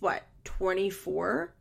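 ordinary talk

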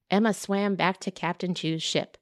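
ordinary delivery, nothing marked

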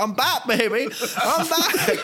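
high, laughing gleeful cry. Pretend. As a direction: crow it with delight. Richard partying again.

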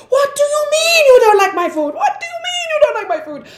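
mock-furious high-pitched yell; enraged chef. Chef yelling at boy who disliked his food, enraged. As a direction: explode like an outraged chef, very high and loud.

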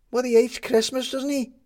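put-on high, loud kid's voice; catching on, eager and high; captain cottoning on for Maddie, high and loud. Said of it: "said the captain, cottoning on".

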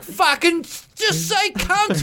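high, slow, laughing heckler voice; mock crude heckler. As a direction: ham up a rowdy punter yelling from the crowd. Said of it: "and our people are like".

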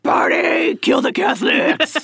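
rowdy party yell; frat bro. Pretend. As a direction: whoop it up like a frat boy. College kids let off leash.

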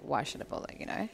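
ordinary delivery

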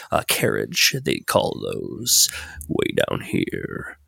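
throat giving out mid-sentence; croaky voice. Real, not acted. GM narrating the carriage while his sick throat gives out. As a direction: voice dropping out to a low hoarse rasp. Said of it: "I have bronchitis".

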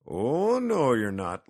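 firm refusal. A narrator's reading. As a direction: swoop up and contradict loudly. Old man refuses to let cat rest.

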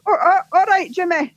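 a hint of the Glasgow van man; mild Scottish ice cream seller. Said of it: "he went".

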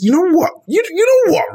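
high, loud, worked-up yelling; exasperated shouting. Acted. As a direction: rant it out, fully fed up. Heated rant about Ganon.

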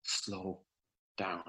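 very soft, halting retold order; strained plea. Labouring wife to speeding husband, imitated hushed strained plea. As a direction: squeeze out each word under the breath. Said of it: "he grabbed my wrist and".

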